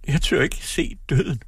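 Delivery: acted sob, high and slow; slow, high-pitched tearful confession; sobbing. A narrator's reading. Daughter dreads seeing death, sobbing. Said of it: "hulkede hun".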